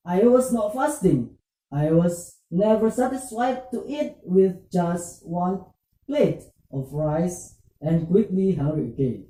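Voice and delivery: usual speaking voice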